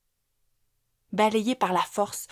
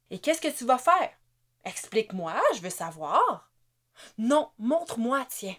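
taunting; 4 semitones higher than usual. A narrator's reading. Wife taunts beaten husband.

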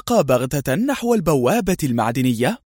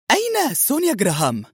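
higher-pitched character voice; Mona's questioning voice; 8 semitones higher than usual. A narrator's reading. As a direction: ask in a raised, lighter pitch. Mona asks in the story. (صوت منى المتسائل بنبرة أعلى)

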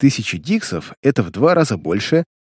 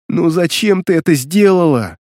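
dismayed exclamation; 5 semitones higher than usual. Acted. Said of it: "сокрушаясь, воскликнул я".

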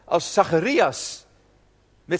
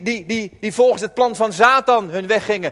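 ordinary delivery

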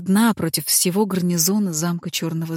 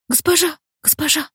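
urgent maid; 10 semitones higher than usual. Acted. Служанка будит хозяйку.

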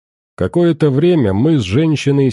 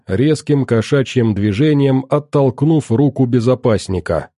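ordinary delivery, nothing marked

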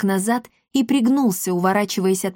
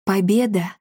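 tentative, understated exclamation; a touch of hesitant disbelief; uncertain. A narrator's reading. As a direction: say it as if not quite sure. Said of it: "Марта неуверенно произнесла".

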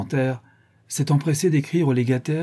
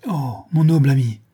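moved voice; 3 semitones higher, 5 dB louder than usual. A narrator's reading. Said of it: "ajouta-t-il d'une voix émue".

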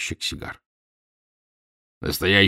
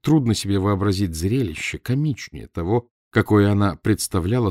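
ordinary delivery

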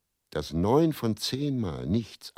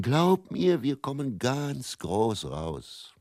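Teddy's big promise; 6 semitones higher, much slower than usual. Retold or imitated. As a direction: sell the dream of the big break. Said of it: "glaubte er unbeirrbar".

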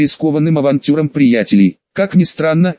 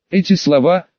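plain reading voice

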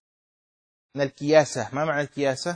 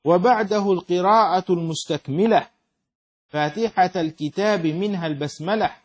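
higher, ringing metrical recitation; verse recitation; 3 semitones higher, 4 dB louder than usual. Acted. Teacher reading the poem on prayer pillars, raised measured recitation. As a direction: recite in a firm, rhythmic lilt.

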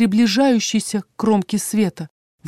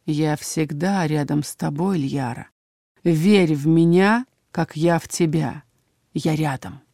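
slow, low, soothing dark figure; low, measured mysterious man; low male voice; 4 semitones lower, 3 dB quieter, slower than usual. A narrator's reading. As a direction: speak slowly in a deep, fading voice. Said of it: "низкий мужской голос".

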